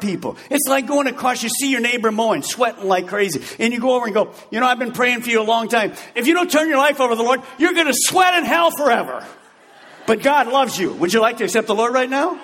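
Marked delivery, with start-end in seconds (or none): 4.5-12.4 fast, rising to a higher yelled hell threat, then sugary; pushy mock witness. Preachy neighbor threatening the mower with hell, fast mock shout. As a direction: hammer the neighbor then soften.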